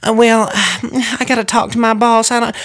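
high, sighing, flustered hedging; flustered shop lady. Retold or imitated. Baker to pushy customers, flustered and stalling. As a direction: stall nervously, passing the buck. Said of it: "she was like".

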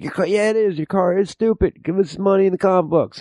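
high, put-on goofy thug voice; silly robber voice. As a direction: play the robber as a squeaky cartoon bully.

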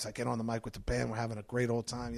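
usual speaking voice

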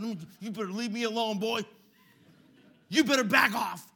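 loud, puffed-up warning to a bully; tough shout. Retold or imitated. Boy warns the bully in a loud tough voice. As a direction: puff up and shout him down.

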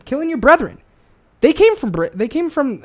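worked up, pitch jumping high; real outrage, punched out high; angry rant. Real, not acted. Ranting to a friend about the founders' war, angry. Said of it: "it makes me fucking angry".

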